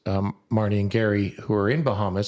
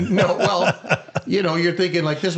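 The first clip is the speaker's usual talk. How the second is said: higher-pitched, talking through laughter; laughing; 7 semitones higher, 3 dB louder than usual. Real, not acted. Answering a friend's teasing about the prank, laughing. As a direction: start answering while still laughing.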